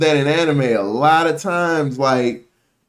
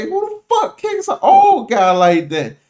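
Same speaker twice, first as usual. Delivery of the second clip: high-pitched incredulous retold reaction; imitating shocked onlookers; mock outrage; 11 semitones higher, 4 dB louder than usual. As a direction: act out appalled disbelief, pitched way up. Grandkids' shocked reaction, acted in retelling.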